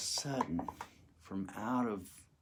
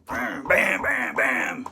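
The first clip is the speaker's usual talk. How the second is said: door kicks; 4 semitones higher, 4 dB louder, much slower than usual. Retold or imitated. Kicking the cell door.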